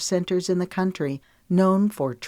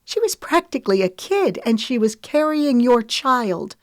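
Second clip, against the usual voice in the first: much higher and louder, shaking with outrage; outraged cry; 7 semitones higher, 5 dB louder than usual. A narrator's reading. Lemon defending the pregnant girl to Wesley, outraged. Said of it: "Her voice trembled with outrage".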